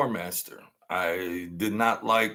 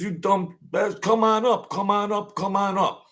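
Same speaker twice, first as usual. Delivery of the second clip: higher, teasing call to come up; mock calling buddy; 9 semitones higher than usual. Buddy teasing him to come upstairs, lightly mocking call. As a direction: egg him on from the room, playfully.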